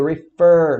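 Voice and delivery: refer with a loud, drawn-out FER; stress on the last syllable. Shown as a demonstration. Teacher modelling the verb for students, refer stressed on FER. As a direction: punch the second syllable of refer. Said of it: "a última sílaba é a mais forte".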